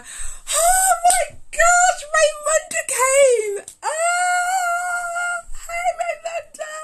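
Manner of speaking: very high, loud, drawn-out squeal; excited squealing. Real, not acted. Greeting Raymonda as she joins the live, squealing with excitement. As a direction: shriek a delighted welcome, stretching the words out.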